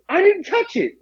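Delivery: high, indignant denial; accused by coworkers, a high defensive protest. Retold or imitated. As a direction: insist you're innocent. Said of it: "I said".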